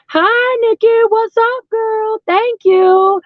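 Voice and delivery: high, sing-out hello to a friend; excited greeting. Real, not acted. Streamer welcoming a friend into the live, real high-pitched excitement.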